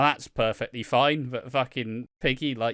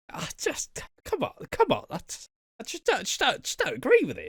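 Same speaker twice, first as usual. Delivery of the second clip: mock spluttering; 10 semitones higher than usual. Imitating Piggy spluttering.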